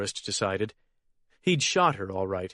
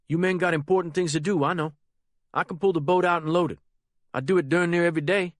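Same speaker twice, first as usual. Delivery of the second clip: put-on higher, fast and eager boy's voice; young Harold, eager; 7 semitones higher, 3 dB louder, faster than usual. The boy brushing off Ozen's offered hand, higher and fast. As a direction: rush it out, anxious to get away.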